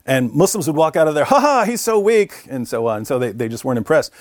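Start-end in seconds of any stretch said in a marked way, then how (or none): 1.2-2.5 mocking laugh. Muslims mocking him after the debate.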